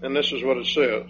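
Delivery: normal talking voice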